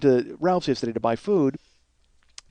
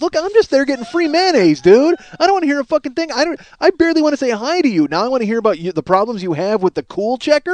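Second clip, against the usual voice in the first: high, loud fed-up rant; raised, exasperated inner rant at the cashier; 8 semitones higher, 4 dB louder than usual. Retold or imitated. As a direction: vent like you can't take another word. Ranting at the checker in his head.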